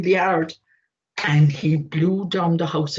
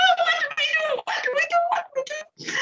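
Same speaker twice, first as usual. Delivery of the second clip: much higher, very fast squealing plea; panicking piglets; 4 dB quieter, much faster than usual. Acted. Piglets begging their brother to stop the wolf. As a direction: gabble it in a piggy panic.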